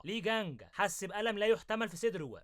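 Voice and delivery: usual speaking voice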